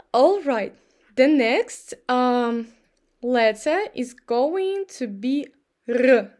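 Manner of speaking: ordinary talk, nothing marked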